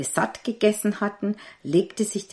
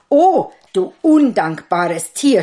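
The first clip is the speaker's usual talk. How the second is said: louder, slow furious cry; angry cry; 4 semitones higher, 10 dB louder than usual. A narrator's reading. Tailor shouting at the goat in fury. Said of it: "schrie er".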